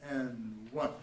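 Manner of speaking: normal talking voice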